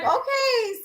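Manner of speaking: a high, stretched, amused okay. Retold or imitated. Agreeing to the client's long drive with a high, amused okay. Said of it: "I'm like".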